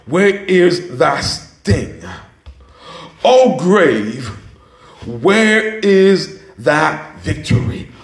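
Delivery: very slow, weighty recitation; declaimed scripture. Real, not acted. Preacher reading Paul's question to death, slow solemn declamation. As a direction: declaim it slowly, word by word.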